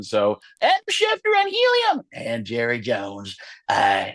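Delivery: ordinary talk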